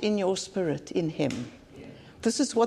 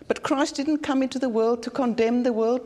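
normal talking voice

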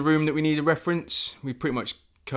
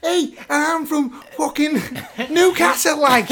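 put-on much higher, slow, laughing Geordie accent; mock Geordie accent; 13 semitones higher, 5 dB louder, slower than usual. Daphne's brother claims Newcastle in a laughing mock Geordie accent. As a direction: stretch it out like a pantomime Geordie. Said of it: "they'll be like".

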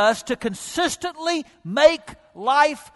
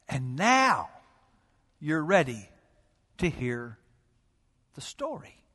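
breathy whisper to draw them in; hushed secret whisper; 7 semitones lower, 5 dB quieter than usual. Real, not acted. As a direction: drop to a bare whisper on the story. Hushed lead-in to the parable.

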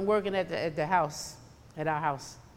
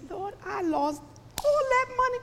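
high-pitched mock wail; fussing; 14 semitones higher, 3 dB quieter than usual. Preacher retelling her complaint to the Lord, fussing in a high voice. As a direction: climb higher into a whiny complaint. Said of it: "I'm sitting at my desk fussing".

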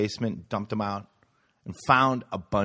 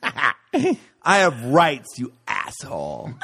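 mock bratty student; 2 semitones higher, 6 dB louder, much slower than usual.

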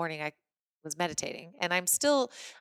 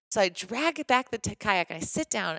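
ordinary talk, nothing marked

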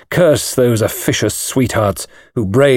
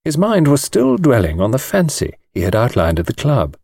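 ordinary delivery

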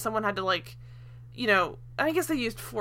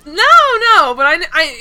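loud protest; 7 semitones higher, 12 dB louder, slower than usual. Real, not acted. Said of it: "defends after teasing".

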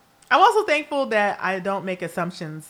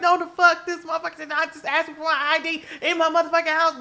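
furious rant; 9 semitones higher, faster than usual. Retold or imitated. Planning an Instagram post about being asked for ID, fast outraged tirade. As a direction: let it fly, outraged in your own home.

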